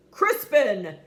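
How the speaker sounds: loud sharp call of the name; angry father shouting his son's name. Acted. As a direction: scold him across the table. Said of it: "shouted his father".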